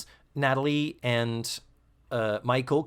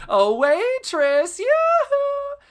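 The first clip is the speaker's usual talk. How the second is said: high, loud, drawn-out call; mock yell to waitress; 7 dB louder, much slower than usual. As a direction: call teasingly across the room in a shrill singsong. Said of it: "yell over to Joe".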